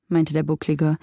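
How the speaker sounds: ordinary delivery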